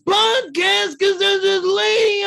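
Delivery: loud, goofy caricature of an excited friend; funny voice. Acted. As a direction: holler it in a silly high voice, stretching each word. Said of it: "trying to do a funny voice".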